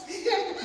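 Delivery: quick breathy laugh; real laugh. Real, not acted. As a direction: chuckle at the story. Laughing at the story.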